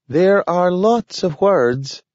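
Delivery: high, slow talking dog; a slow, careful talking dog. A narrator's reading. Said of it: "said Nathaniel".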